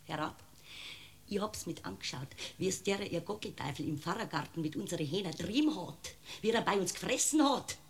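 accused woman confiding her grievance to the judge; confiding, hushed. Acted. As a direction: lean in and tell it in confidence.

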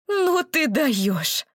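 slow indignant exhale; exasperated. Acted. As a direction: sigh out disbelief. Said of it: "выдохнула ... воздух возмущения".

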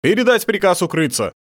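high, loud order; commanding. A narrator's reading. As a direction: order the troops urgently.

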